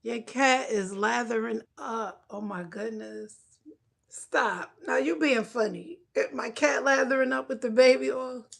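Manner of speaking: usual speaking voice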